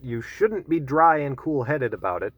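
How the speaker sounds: ordinary talk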